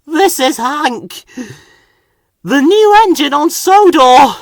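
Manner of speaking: out of breath. Acted. Thomas still out of puff.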